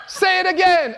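put-on high, insistent Jamaican-accented command. Friend ordering Junior to repeat it, Jamaican accent. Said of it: "I'll do my Jamaican accent".

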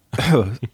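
sudden sputtering cough; choking cough. Real, not acted. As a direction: cough as if a drink went down wrong.

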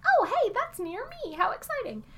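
mock excitement. Mocking her own excitement about a game set nearby, high. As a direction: gush like a delighted fan. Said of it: "And I was like".

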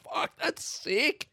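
high, slow, stretched delight; amazed. Acted. Third guy marvels at his spinning arms, high and slow. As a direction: savour the thrill of it.

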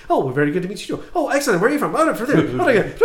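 quick, high, laughing gnome patter; fast gnome chatter. Retold or imitated. Game master imitating player's fast gnome banter, laughing. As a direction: gabble it back like an excited gnome. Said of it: "you started speaking fast back to me".

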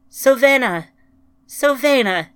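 loud, high cry of grief; anguished cry. A narrator's reading. Ryan calls to the planet in grief, crying out. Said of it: "she cried to the planet".

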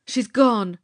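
loud, dismayed outcry; anguished cry. A narrator's reading. Charlie seeing the girl vanish, a loud dismayed cry. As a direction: cry it out as hope is lost. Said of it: "cried Charlie".

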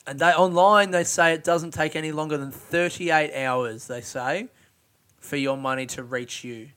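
ordinary talk

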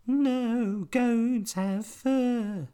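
very high, slow squeaky spider; tiny spider voice. A narrator's reading. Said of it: "said the spider".

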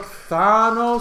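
"Thanos" drawn out, open "ah"; slow, questioning "Thanos", broad first vowel; in-between pronunciation. Shown as a demonstration. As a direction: stretch "Thanos" slowly as THAH-nohs. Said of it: "It's a mix between".

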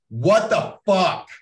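loud, slow disbelief; shocked reaction. Acted. Speaker voicing viewers seeing the cut, shocked. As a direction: punch out each word, stunned. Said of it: "You're gonna be like".

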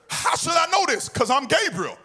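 Gabriel retorting. Acted. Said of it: "angel retort".

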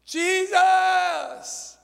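high, full-voiced shout of the name; a desperate call over the crowd. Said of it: "he cried even the louder".

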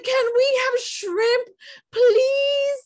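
high, slow whiny plea; kid whining. Retold or imitated. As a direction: stretch the please like a begging child. Son asking for shrimp.

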